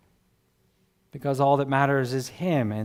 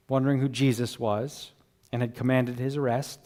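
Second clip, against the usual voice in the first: usual speaking voice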